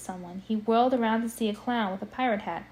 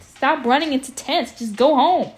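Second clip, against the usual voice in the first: louder, fed-up call to the character; reader's aside, louder and exasperated; exasperated scolding; 3 semitones higher, 7 dB louder than usual. Real, not acted. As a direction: tell him off half-laughing.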